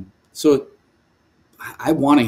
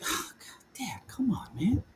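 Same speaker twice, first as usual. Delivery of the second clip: breathy, hushed groan of protest; exasperated whisper; 7 semitones higher, 12 dB quieter than usual. Retold or imitated. Son groaning at his dad's advice, whispered. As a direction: sigh it out like a put-upon son.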